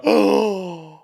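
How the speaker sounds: loud, high gasp of outrage; mock shocked gasp. Man acting out how people react today, mock shocked gasp. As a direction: gasp as if scandalized.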